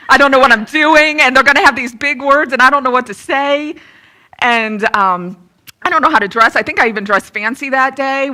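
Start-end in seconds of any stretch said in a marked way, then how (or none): 0.1-6.6 panicked inner worry. Retold or imitated. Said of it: "and I'm thinking".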